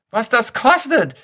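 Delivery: put-on shrill old lady crying out; shrill shriek. Old pensioner on the street, shrieking shrill. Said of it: "gellt die eine".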